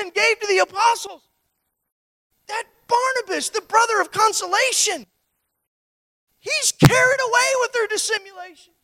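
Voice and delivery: loud, high, driving sermon delivery; preaching at full force. Acted. Preacher stirring the congregation about Peter, loud and high. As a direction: preach it out to the back row.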